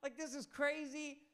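high-pitched, incredulous reaction; acted bewilderment. Nicodemus reacts to Jesus' puzzling answers, acted bewilderment. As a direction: question it as if utterly baffled. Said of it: "kind of making him be like".

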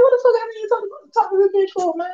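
high, fast, scoffing disbelief; mock outraged quote; incredulous retort. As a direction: toss it off like it's absurd. Retelling his own annoyed reaction.

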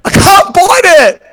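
high, drawn-out cry of frustration; exasperated outcry. Real, not acted. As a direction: wail it slowly in comic exasperation. Complaining about rock-hard ice cream.

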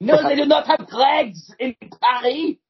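hammed-up indignant French woman; French accent. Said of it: "This is a strange accent".

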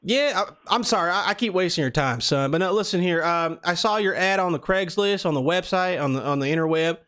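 put-on Southern prank caller; Southern accent. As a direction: talk like a folksy Southern customer. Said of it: "we're doing the Southern accent".

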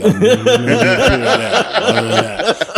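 loud, high joking through laughter; loud laughing. Real, not acted. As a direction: shout the joke while laughing.

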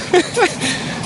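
unvoiced high laugh at interruption; breathy laugh. Real, not acted. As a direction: laugh off the noisy train. Laughs at passing train.

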